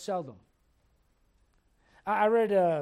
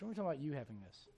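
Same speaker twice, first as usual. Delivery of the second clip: quick aside; 8 semitones lower, 13 dB quieter, much faster than usual. Real, not acted. Punchline aside to congregation.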